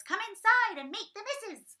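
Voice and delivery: high, loud silly worm voice; puppet worm voice. Retold or imitated. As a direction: squeak it like a cheeky puppet worm. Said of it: "puppet worm that says".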